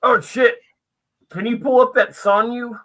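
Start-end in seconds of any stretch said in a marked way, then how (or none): none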